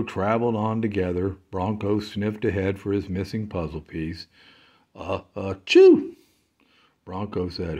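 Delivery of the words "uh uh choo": loud sneeze. Acted. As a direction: build up and sneeze. Goat's sneeze.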